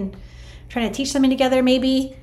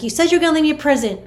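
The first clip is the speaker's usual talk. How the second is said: quick, high, excited thank-you; 6 semitones higher, 4 dB louder, faster than usual. Retold or imitated. To her late friend, quick excited high thanks. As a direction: burst out with grateful excitement.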